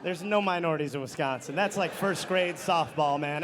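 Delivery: normal talking voice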